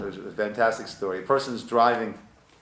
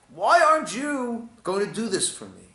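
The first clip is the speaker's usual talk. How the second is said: higher, louder aggrieved complaint; upset demand; 10 semitones higher, 6 dB louder than usual. Acted. Someone upset that another won't help, aggrieved. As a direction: whine it like you've been wronged.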